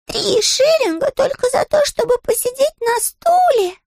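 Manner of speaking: high, slow little bear voice; indignant high bear, drawn out; Paddington voice. Acted.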